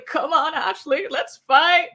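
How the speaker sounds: mock a scared girl who only pretends to be brave; mock scared challenge. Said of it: "her knees trembling".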